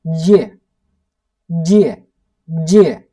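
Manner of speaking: Где repeated with a hard g; pronunciation drill. Shown as a demonstration. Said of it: "But that is not где".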